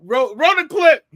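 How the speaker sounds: high, loud mock command; acted urgent call. Acted call to promotion team.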